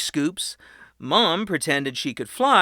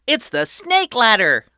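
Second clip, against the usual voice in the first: put-on high, loud tour-guide kid; proud boy host; 8 semitones higher, 8 dB louder than usual. A narrator's reading. Patrick welcoming his family underground, high and proud. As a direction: announce it like a grand showman. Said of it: "he shouted".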